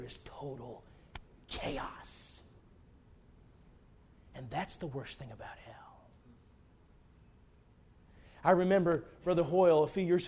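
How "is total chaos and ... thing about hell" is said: hushed, breathy whisper of dread; softer whispered warning about hell. Acted. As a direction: drop to a whisper to chill them. Climax about total chaos in hell.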